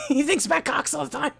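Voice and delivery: very high, very loud, voice cracking with rage; impression of angry friend. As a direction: scream it like a furious friend standing on his chair. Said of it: "my impression".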